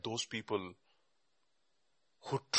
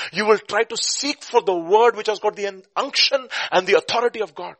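emphatic preaching; 10 semitones higher, 8 dB louder than usual. Real, not acted. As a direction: drive the charge home to the congregation.

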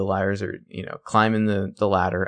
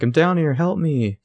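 higher, pleading call from below; mock cry for help; 7 semitones higher than usual. Trapped girl calling up to her rescuers, mock plea.

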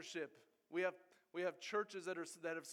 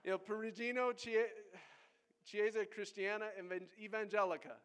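higher, louder second go at Italian "Il Perugino Chiesa Cristiana Evangelica"; hammed-up Italian accent, "Evangelica" said the Italian way; Italian accent retry; 5 semitones higher, 5 dB louder than usual. Shown as a demonstration. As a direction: push through the Italian name with gusto. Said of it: "I always mess up".

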